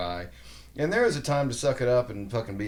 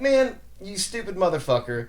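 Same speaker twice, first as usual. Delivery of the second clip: higher, exasperated insult; mock angry retort; 9 semitones higher, 4 dB louder than usual. Reacting to a rude online commenter, exasperated insult. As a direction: scoff at the commenter.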